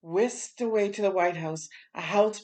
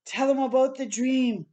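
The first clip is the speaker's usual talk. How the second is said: raised, urgent call from the crowd; gospel singer's shout; 6 semitones higher, 4 dB louder than usual. Acted. Mahalia calling out to Martin at the podium, raised shout. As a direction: call out to him to share the dream. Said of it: "Again she shouted".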